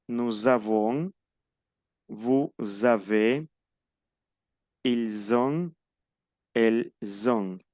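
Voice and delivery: slow models, final consonant linked to the next vowel; liaison. Shown as a demonstration. Teacher models linking for students, slow Z liaison in "Nous avons". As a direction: link "vous avez", "ils ont", "elles ont" with a Z. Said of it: "termina en consonante y comienza por vocal".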